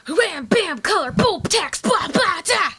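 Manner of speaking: mimicking comic noise. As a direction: spit out the comic sound effects like a barrage. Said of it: "There's so much going on".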